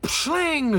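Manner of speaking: high, drawn-out shattering effect; imitated glass shatter. Lecturer imitating the glass-breaking machine's sound, high shatter. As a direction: voice the splintering and tinkling of glass. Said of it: "how they get that".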